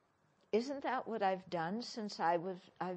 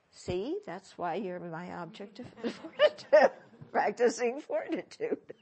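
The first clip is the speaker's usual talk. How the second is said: louder, chuckling through it; laughing reply; 7 dB louder than usual. Acted. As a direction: tease the accuser kindly. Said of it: "acted reply to accuser".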